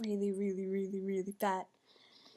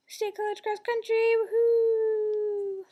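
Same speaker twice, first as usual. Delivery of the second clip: high, drawn-out cheer; excited team cheer; 11 semitones higher, 5 dB louder, slower than usual. Real, not acted.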